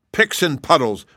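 loud high roar; roaring oath. A narrator's reading. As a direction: bellow the oath in fury. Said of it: "roared the king".

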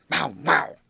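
yard dog's barked words. A narrator's reading. Chained dog ending his tale, barking. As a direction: bark the words out. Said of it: "barked the yard dog".

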